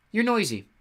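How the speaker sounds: high, sharp complaint; complaining. Acted. Huntress scolding intruders, complaining. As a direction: scold them for the noise. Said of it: "she complained".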